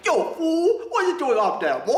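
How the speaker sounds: mock Brooklyn accent, yelled up at a boy, half-laughing; bad Brooklyn accent. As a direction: play a tough Brooklyn kid calling up to someone, over the top. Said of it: "that's a bad accent".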